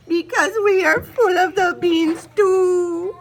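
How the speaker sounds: high, loud, slow fearful cry. Acted. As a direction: cry it out in fear. Poquito explains in fear.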